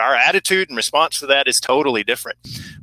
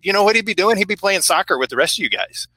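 higher, eager punchline; excited reveal; 7 semitones higher than usual. Retold or imitated. Counselor answering sixth graders at camp, high and eager. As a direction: pitch it up, eager to surprise the kids. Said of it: "I'm like".

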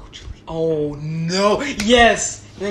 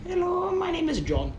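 fake caller voice; 9 semitones higher, 4 dB quieter than usual. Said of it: "any voice you do, you still sound like you".

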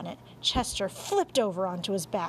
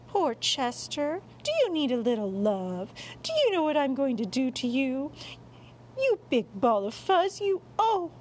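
put-on high, gushing baby talk to a pet; doting mother; 5 semitones higher than usual. Mom cuddling the cat, doting in a high voice. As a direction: coo and fuss over a kitty.

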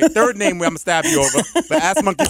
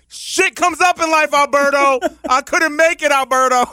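mock flaky excuse; 9 semitones higher than usual. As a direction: whine it out, dragging each word. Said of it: "So I'm gonna be like".

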